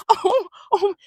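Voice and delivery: high, flustered mock "oh"s; imitated high cries of cover-up; covering noises. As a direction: make noise to drown something out. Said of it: "trying to make noises".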